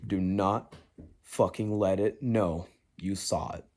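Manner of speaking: low, soft frozen inner voice; frozen hush. Retold or imitated. Telling himself not to react to the face, hushed and low. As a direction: hold still and say it under your breath. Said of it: "I'm fucking frozen".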